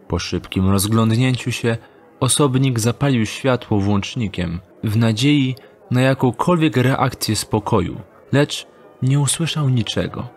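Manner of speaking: usual speaking voice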